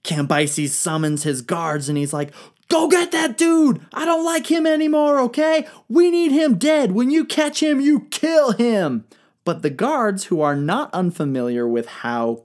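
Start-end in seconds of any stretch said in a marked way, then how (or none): 2.7-9.0 high, loud raging command, slowing on the last words; king's furious order. Acted. Angry king ordering his guards, high loud shout. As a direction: bellow at the guards to hunt him down.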